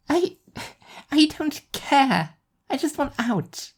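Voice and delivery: put-on a teenager who just wants out; high frustrated teen girl. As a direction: Nadine shutting the argument down.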